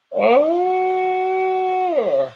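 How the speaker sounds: long high yawn with voice. Real, not acted. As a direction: stretch it into a yawning oh. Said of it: "I'm yawning again".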